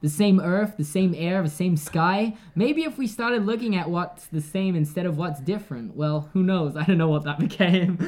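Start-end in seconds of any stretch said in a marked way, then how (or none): 0.0-6.0 British speaker trying on American; put-on American with a touch of New York; attempted American accent. Shown as a demonstration. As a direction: copy an American accent, reading it out carefully. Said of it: "pick up one of your accents".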